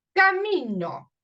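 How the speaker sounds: teacher stretches camino to show the stressed syllable; stressed demo word. Shown as a demonstration. Teacher showing students the stressed syllable, drawn out. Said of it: "No digo camino".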